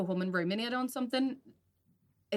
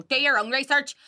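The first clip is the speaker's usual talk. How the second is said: louder, higher firm retort; 7 semitones higher, 10 dB louder than usual. Retold or imitated. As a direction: push back firmly. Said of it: "You have to go".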